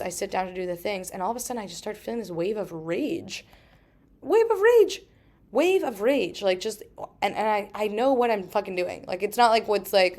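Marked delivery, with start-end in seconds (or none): 4.2-5.0 high, loud mock-furious voice; hammed-up burst of rage. Acts out sudden wave of rage. 5.5-6.4 raised, higher mock-angry voice; mildly hammed-up anger; mock rage. Repeats the acted rage, milder.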